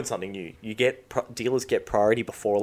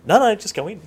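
exasperated reply; 11 semitones higher, 9 dB louder than usual. Retold or imitated. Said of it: "retold queue reply".

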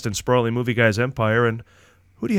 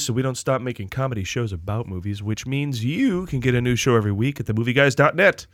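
usual speaking voice

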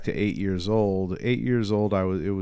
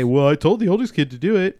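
smug excuse; 8 semitones higher, 5 dB louder than usual. Retold or imitated. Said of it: "You can say".

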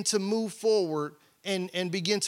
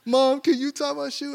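put-on child asking mom; 6 semitones higher than usual. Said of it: "You know".

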